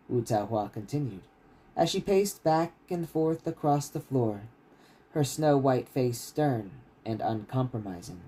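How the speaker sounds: steady, measured reading of a fantasy novel; story narration. A narrator's reading. Author reading his novel to stream listeners, steady narration. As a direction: read the chapter out like an audiobook.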